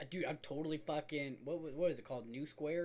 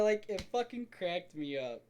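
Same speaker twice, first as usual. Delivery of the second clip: ordinary talk, nothing marked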